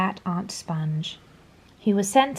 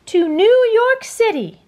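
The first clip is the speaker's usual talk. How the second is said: much higher and louder, eager cry; excited exclamation; 12 semitones higher, 6 dB louder than usual. A narrator's reading. James eagerly naming their goal, loud and high. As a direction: call it out full of hope. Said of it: "James exclaimed".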